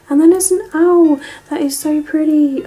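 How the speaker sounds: excited reveal of an owl charm. Real, not acted. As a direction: gush over the owl. Excited discovery.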